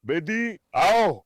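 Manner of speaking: very slow, drawn-out coaxing call; coaxing dog. Real, not acted. Coaxing new female dog.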